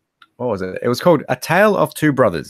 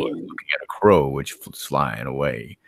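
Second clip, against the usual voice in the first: mock storyteller; slower than usual. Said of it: "No, I'm kidding".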